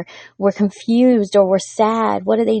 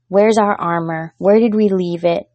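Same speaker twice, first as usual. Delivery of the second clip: ordinary talk, nothing marked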